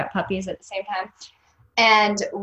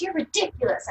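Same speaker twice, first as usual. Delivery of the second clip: high, badly faked French scoff; mock French boss, pitched up; bad French accent; 11 semitones higher than usual. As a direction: dismiss them in a poor French accent. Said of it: "I can't do a French accent".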